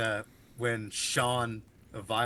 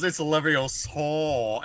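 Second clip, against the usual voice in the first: slow mock Leviosa; 5 semitones higher, 4 dB louder, much slower than usual. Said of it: "And he goes".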